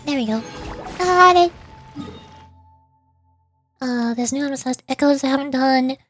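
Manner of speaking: ordinary talk, nothing marked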